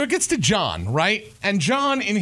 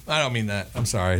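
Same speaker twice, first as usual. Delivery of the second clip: ordinary delivery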